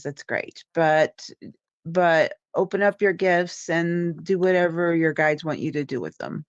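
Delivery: ordinary talk, nothing marked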